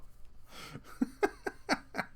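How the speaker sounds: high, soft trailing chuckle; real laugh. Real, not acted. Host amused after saluting married viewers, soft real laugh. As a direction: laugh softly to yourself.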